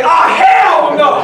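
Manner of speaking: high, loud laughing shout; retold outburst. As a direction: shout it in disbelief. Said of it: "And I wanna say".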